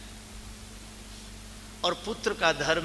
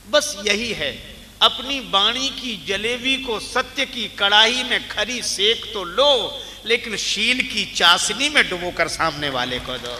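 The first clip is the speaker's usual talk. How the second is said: loud, impassioned sermon delivery; preaching at full force; 5 semitones higher, 8 dB louder than usual. Acted. Preacher urging listeners to sweeten truth with kindness, impassioned. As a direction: hammer the moral home to the crowd. Preacher drives home the moral.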